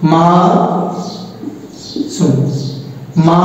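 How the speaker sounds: মা and শুন said apart with pauses; syllable by syllable. Shown as a demonstration. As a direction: split the name Masum into syllables. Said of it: "একটা অক্ষর".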